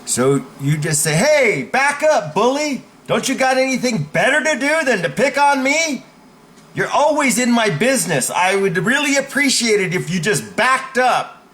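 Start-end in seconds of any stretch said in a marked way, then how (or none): 1.1-11.5 high, forceful raised voice; demonstrated indignant shout, easing slightly at the end; loud assertive pushback. Shown as a demonstration. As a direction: confront the bully so the whole room hears. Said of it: "it's a loud voice, it's assertive".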